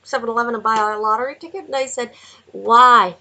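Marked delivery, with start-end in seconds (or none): 2.5-3.2 loud why. Retold or imitated. Retold reply.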